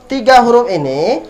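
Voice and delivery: ordinary talk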